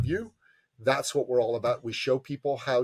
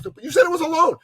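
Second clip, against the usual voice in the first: puzzled friend; 8 dB louder than usual. Retold or imitated. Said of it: "He goes".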